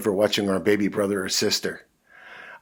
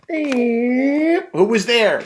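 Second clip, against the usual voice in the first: slow, high drawn-out question; suspense; 13 semitones higher, 8 dB louder, much slower than usual. Acted. Storyteller building suspense before the dog appears. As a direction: stretch it out for suspense.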